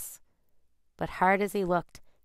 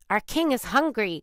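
raised, higher announcing cry; 5 semitones higher, 5 dB louder than usual. A narrator's reading. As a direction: call it out like a herald crow. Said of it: "cried the crow".

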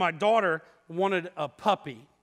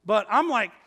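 ordinary talk, nothing marked

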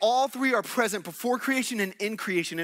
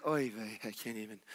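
much lower and far softer, worn out; exhausted groan; 10 semitones lower, 12 dB quieter than usual. Retold or imitated. Moses drained by grumbling people, low and barely audible. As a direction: let it sag out of you. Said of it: "he gets so fatigued".

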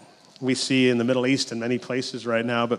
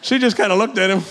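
words through laughter, higher and louder; laughing; 6 semitones higher, 5 dB louder than usual. Real, not acted. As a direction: still laughing as you tell it. Laughing after punchline.

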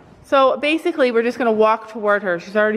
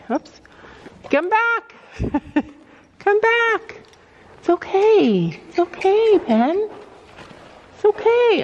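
high soothing pony voice; 8 semitones higher, slower than usual. Real, not acted. Owner calming pony near the stick, high coaxing praise. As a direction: reassure a frightened animal up high. Calling scared pony back.

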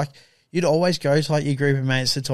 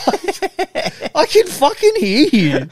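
higher, louder and slow, drawing out the outrage; mock indignant yell; 12 semitones higher, 5 dB louder, much slower than usual. Gamer catching mates trash-talking him, mock yell. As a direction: call them out, half laughing. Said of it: "and it's like".

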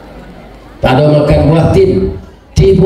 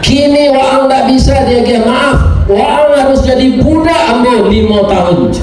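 king's angry shout; 10 semitones higher than usual. Acted. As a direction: bellow the sentence down at him like a wrathful king. King scolds the boy harshly.

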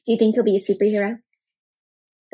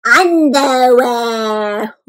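Dracula voice; 6 dB louder, much slower than usual. A narrator's reading. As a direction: draw out every word like a hammy vampire. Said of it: "in his best Dracula voice".

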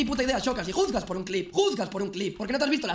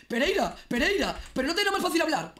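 mocking quote; 6 semitones higher, 3 dB louder than usual. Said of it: "que van a decir".

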